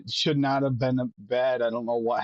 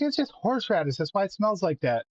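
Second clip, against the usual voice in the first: much higher, airy dismissive voice; mocking his wife; 6 semitones higher than usual. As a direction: mimic her brushing it off, pitched way up. Mimics his wife's dismissal.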